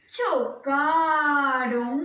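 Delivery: alargado. Acted. Explica el choque de carros.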